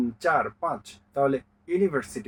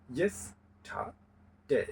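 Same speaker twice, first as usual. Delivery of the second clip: "Yesterday" slow and softer, in three separated parts; split into syllables; 8 dB quieter, much slower than usual. Shown as a demonstration. Teacher showing three syllables, "yesterday" slowly split with pauses. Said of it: "তিনটি syllable আছে".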